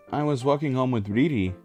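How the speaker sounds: usual speaking voice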